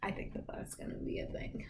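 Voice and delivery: hushed whisper. Real, not acted. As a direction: drop to a breathy whisper. Late night, partner sleepy.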